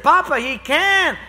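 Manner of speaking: high, loud protest; insistent child. Retold or imitated. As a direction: insist like a small boy.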